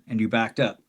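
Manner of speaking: ordinary talk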